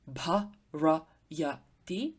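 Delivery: bharayati drawn out slowly, bha-ra-ya-ti each syllable separate. Shown as a demonstration. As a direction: spell out bharayati slowly so the light first syllable is heard. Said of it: "would have a light first syllable".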